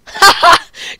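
a burst of high, loud laughter; high cackling laugh. Real, not acted. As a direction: laugh out at the cheater. Laughing at combat log.

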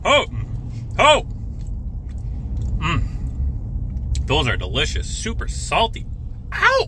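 chewing hot food while talking; breathing out around hot food; mouth full of hot fries. Real, not acted. Seen 0: Eats crinkle fries throughout, mouth open chewing hot food while talking.

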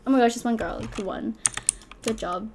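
normal talking voice

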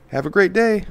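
high, bored checkout clerk; flat rote cashier. Retold or imitated. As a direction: rattle it off like a tired cashier. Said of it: "Lackluster checkout".

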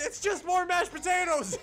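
high, loud shout through laughter; laughing shout. Retold or imitated. Toby as astronaut answering the box question, laughing shout. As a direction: cry it out while cracking up.

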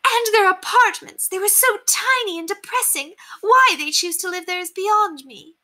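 high, theatrical society-lady voice; haughty lady, appalled. A narrator's reading. Lady telling governess of shocking paupers, haughtily appalled. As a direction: dwell on the horror in a high, affected voice. Narrator plays Lady Constance.

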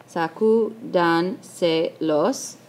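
"Sacúdanselos" slow, with dan, se and los set apart; counting syllables. Shown as a demonstration. Teacher correcting the word and counting, "Sacúdanselos" slowly syllable by syllable. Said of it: "a contar".

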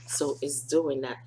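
usual speaking voice

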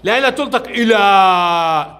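لا stressed and the phrase slowed, إلى held out; teacher slowly correcting the pupil's pronunciation; slow correction. Shown as a demonstration. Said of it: "تنطق ألف".

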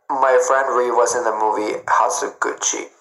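ordinary talk

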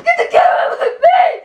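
tearful outburst at the brother filming; crying shout. Real, not acted. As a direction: scream at the camera through tears. Brother films him while crying.

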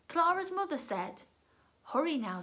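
ordinary delivery

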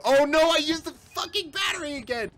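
high, loud frustrated cry; dismayed yell. Real, not acted. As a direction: yell at your own blunder. Wastes battery in panic.

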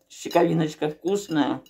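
chewing while talking; words muffled by food; mouth full. Real, not acted. Seen 3: Mouth working on food, fork on plate, eating throughout.

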